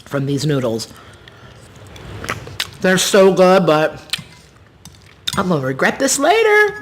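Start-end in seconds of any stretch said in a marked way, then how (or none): 2.8-6.8 talking through spicy burn; mouth on fire. Real, not acted. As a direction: speak with a burning mouth. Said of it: "my mouth is on fire".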